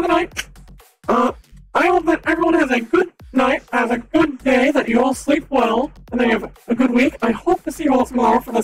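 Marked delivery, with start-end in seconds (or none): none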